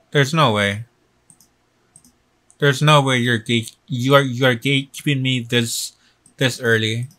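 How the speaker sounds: ordinary delivery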